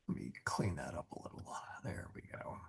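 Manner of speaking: whispered under the breath; whispered aside. Real, not acted. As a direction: mutter it to yourself in a whisper. Quiet aside while fixing screen.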